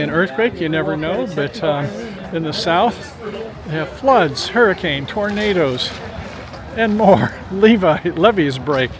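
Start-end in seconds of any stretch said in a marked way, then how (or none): none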